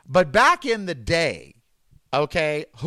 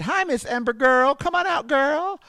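pet talk; 8 semitones higher than usual. Real, not acted. Calling to her dog.

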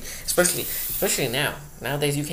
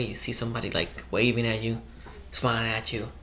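ordinary delivery

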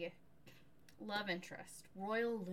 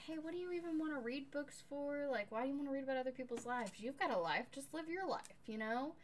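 dismissive suitor; 6 semitones higher, faster than usual. Retold or imitated. Voicing Royal courting her.

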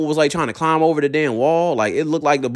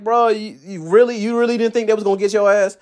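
scoffing disbelief; 8 semitones higher than usual. Retold or imitated. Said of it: "I'm like".

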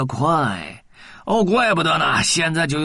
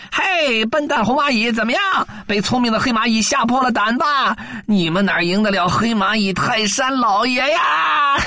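high mocking jeer, pitched way up; gloating taunt; 9 semitones higher than usual. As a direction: sneer at the fooled ants. Villain taunts the red ants.

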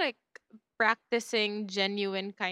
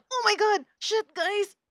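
excited; 10 semitones higher, 4 dB louder than usual. Retold or imitated. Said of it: "ni Ana saying nga".

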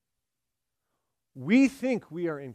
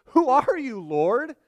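high, alarmed cry of a stunned man; startled question; 8 semitones higher, 6 dB louder, slower than usual. Retold or imitated. Saul, struck down, cries up to the voice in alarm. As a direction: gasp it out, thrown to the ground.

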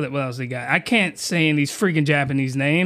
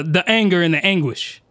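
normal talking voice

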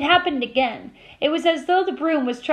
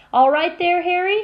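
higher, lightly raised call; mild yell; 5 semitones higher, 4 dB louder than usual. A narrator's reading. Fred checks on Harry mid-game, mild yell. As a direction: call out to a teammate in passing. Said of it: "he had time to yell".

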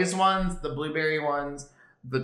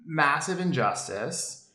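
normal talking voice

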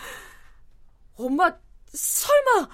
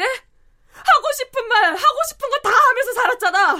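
mocking mother's words back; 5 semitones higher, 4 dB louder than usual. Daughter flings mother's vow back at her, bitterly. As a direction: throw her own words back, sharp and louder. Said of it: "엄마가 언제 참았는데".

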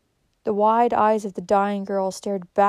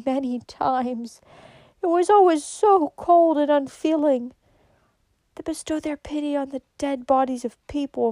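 higher, slowing at the ends; impassioned reproach; 5 semitones higher than usual. A narrator's reading. Dying girl condemns the church, impassioned reproach. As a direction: lift the voice in wounded protest.

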